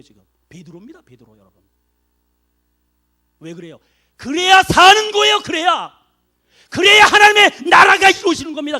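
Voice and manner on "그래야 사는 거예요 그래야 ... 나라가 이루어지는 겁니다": full-force preaching. Real, not acted. Pastor urging the church, shouting the point. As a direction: hammer the point home to the congregation.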